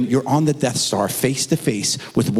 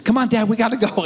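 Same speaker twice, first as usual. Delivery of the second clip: put-on higher, pleading little boy; kid voice; 7 semitones higher, faster than usual. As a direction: beg like an impatient kid. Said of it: "My son goes".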